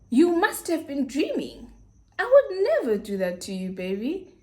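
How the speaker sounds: hammed-up, swooping imitation of his denial; mock innocent denial. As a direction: mimic him protesting, with a loud incredulous finish. Mimics boyfriend's exaggerated denial.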